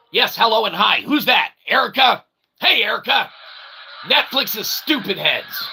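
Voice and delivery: ordinary talk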